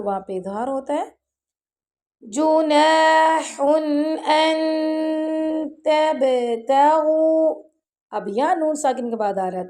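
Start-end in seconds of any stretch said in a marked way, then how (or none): 2.2-7.6 slow, drawn-out melodic Quran recitation; tajweed recitation. Shown as a demonstration. Teacher reciting the verse for students. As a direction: chant each verse slowly with tajweed.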